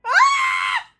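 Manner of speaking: very high, loud scream; shocked scream. Real, not acted. Viewer reacting to the pregnancy, screaming.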